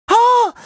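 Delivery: high, sudden cartoon huh; startled huh. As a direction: pop out a high questioning grunt. Wordless reaction sound.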